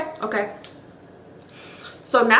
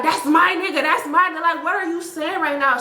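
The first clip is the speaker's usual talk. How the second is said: higher-pitched mimicking comeback; mock retort; 7 semitones higher than usual. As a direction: throw her possessive claim back at her, mockingly. Mock retort echoing her possessive claim.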